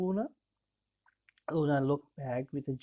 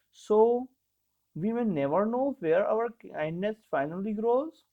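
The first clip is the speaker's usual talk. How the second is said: put-on a boy's voice, higher, eager wonder; Oliver's wondering question; 6 semitones higher, 3 dB louder than usual. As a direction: ask it like a curious child, lifting high. Oliver asks Luna, wondering.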